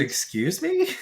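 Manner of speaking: high, rising incredulous question; retold disbelief. Son learning from mom his brother was asleep in the car, mock incredulous. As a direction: act out being taken aback.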